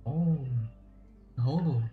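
ordinary talk

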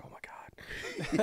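high, breathless whisper of dread; slow horrified whisper. Retold or imitated. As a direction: whisper it in horror, drawn out slowly. Dread as she approaches.

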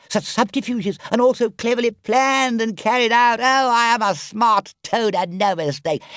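high, crowing Toad voice; boastful Toad. A narrator's reading. Toad bragging to Rat about his escapes, boastful.

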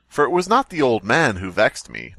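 ordinary talk, nothing marked

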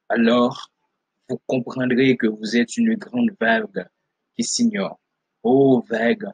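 declaimed verse, broken into short weighted phrases; emphatic poetry reading with pauses between images; poem recitation. Acted. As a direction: declaim it like a poet addressing a crowd. Reads a poem aloud.

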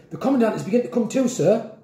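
high, rushed report to a superior; urgent warning. A narrator's reading. Jack warning Grandpa the Commandant is waking, urgent and rushed. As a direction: warn him fast and urgently. Boy warns Grandpa in panic.

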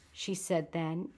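plain reading voice

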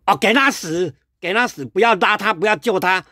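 high, fast jeering voice; mocking bystander. Imagined onlookers jeering at the fallen man, high and fast. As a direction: sneer it out, urging someone to let him die. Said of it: "可能会说什么".

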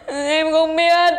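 slow, wailed non-answer; crying. Acted. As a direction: bawl it, stretching the words in mock misery. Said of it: "đừng khóc".

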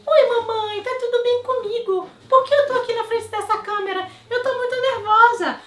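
very high, loud squeaky puppy voice; dog's voice. Acted. Dog Clarinha answering her owner, squeaky puppy voice. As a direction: squeak like a nervous little dog. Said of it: "o cachorro me responde".

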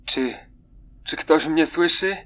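soft, stammering, frightened call; desperate stammer. Acted. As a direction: stutter into the radio in despair. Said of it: "Z rozpaczą wyjąkał". (z rozpaczą, jąkając się, cicho)

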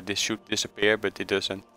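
ordinary delivery

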